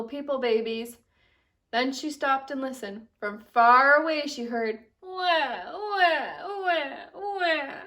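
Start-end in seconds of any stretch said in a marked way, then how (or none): 5.0-7.7 put-on high baby wails; higher mock wah cries; baby crying. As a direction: cry like a baby far away.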